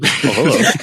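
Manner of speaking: a polite little startled hello; mock startled greeting. Shown as a demonstration. Gamer greeting an enemy with mock startled politeness. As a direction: act calmly surprised by an enemy. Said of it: "That's my startled impression".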